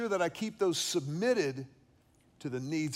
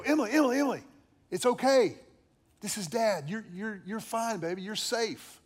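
urgent calling; 5 semitones higher than usual. Retold or imitated. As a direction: call her out of the nightmare. Father waking screaming daughter.

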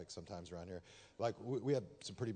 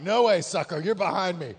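loud, higher mock-angry driver taunt; mock road rage; 7 semitones higher, 18 dB louder than usual. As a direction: snap at the car cutting in. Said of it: "I'm like".

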